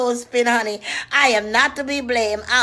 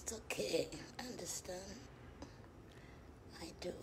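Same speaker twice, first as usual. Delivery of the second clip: soft whispered reassurance; soothing whisper; 6 semitones lower, 28 dB quieter, much faster than usual. Acted. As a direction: hush and console. Comforting a caller in whisper.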